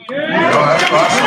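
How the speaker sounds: proud, excited payoff to the room; emphatic punchline. Real, not acted. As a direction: pitch it up to land the point.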